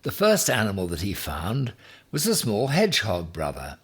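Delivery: ordinary delivery, nothing marked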